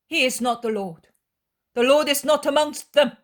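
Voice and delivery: loud, high, resolute; firm final denial. Acted. Young woman declaring the Lord is absent, resolute. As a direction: close the matter with certainty.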